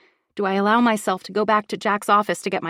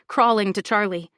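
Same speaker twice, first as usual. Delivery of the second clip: ordinary delivery, nothing marked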